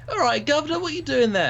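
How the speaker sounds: very high mock Cockney accent; over-the-top Cockney. Shown as a demonstration. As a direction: ham up a squeaky Cockney greeting. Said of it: "I'd have to do the Cockney".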